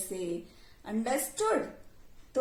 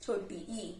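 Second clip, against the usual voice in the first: softer, lower short ई; lowered, soft teaching voice; slow voice; 4 semitones lower, 7 dB quieter, slower than usual. Shown as a demonstration. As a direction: say छोटी ई gently, with ई kept short. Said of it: "slow voice".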